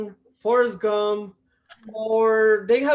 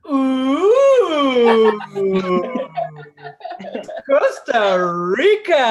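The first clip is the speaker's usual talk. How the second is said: gushing delight; 6 dB louder than usual. Retold or imitated. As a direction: gush like it's the most exotic place.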